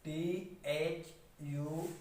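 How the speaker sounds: letter by letter, drawn out for students; slow spelling. Shown as a demonstration. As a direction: spell each letter very slowly. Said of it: "थम". (अक्षर-अक्षर धीरे-धीरे)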